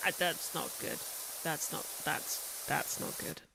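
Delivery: muttering to self. Retold or imitated. Retold own worried reaction.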